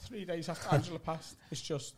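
mild grief breaking off; choked-up MC. Retold or imitated. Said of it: "this is how he said it".

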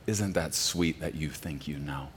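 quiet, syrupy condescension; patronizing. Retold or imitated. Mimicking a smug deconstructionist, quietly patronizing. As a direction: pat them on the head with words. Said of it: "a patronizing tone of".

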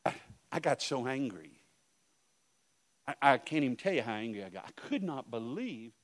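usual speaking voice

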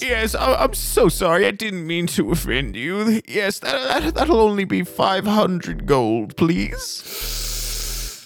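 high, flustered character voice; apologetic cab driver. Acted. Game master plays apologizing cab driver.

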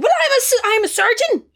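high, loud boast; smug one-upper. Retold or imitated. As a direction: show off being a surgeon. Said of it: "He's like".

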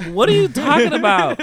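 high, loud retold disbelief; loud high-pitched what-are-you-talking-about; shocked disbelief. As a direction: act out being caught off guard. Retold shocked reply to boss.

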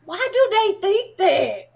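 high, loud, drawn-out disbelief; slow, exclaimed bafflement; incredulous question. Real, not acted. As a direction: stretch it out in exasperated disbelief. Incredulous at others' assumption.

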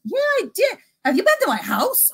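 quoting an amazed client; voiced client.

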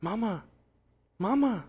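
mock calling mama from the next room; imitating a voice calling for his mother; calling for mom. Son calling for his mom in the kitchen, mock plea. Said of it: "I heard you literally close to me going".

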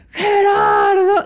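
high, drawn-out plea by name; mock wailing call. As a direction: whine for the boyfriend, stretched long. Pleading to boyfriend in the story. (lamento fingido, agudo y alargado)

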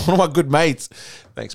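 ordinary talk

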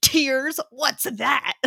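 mock bafflement. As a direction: play baffled by the very idea. Mocking his emotionless reaction to crying.

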